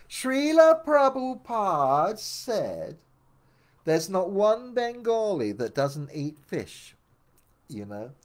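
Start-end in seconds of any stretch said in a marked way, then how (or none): 0.1-6.9 much higher, louder, slow, booming pronouncement; imposing guru quote. Retold or imitated. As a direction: hammer it down like a final verdict. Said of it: "he stopped me and he said".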